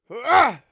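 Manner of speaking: sudden loud yelp of pain; hammed-up stab scream; mock pained scream. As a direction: scream like the knife just went in. Acting out being stabbed.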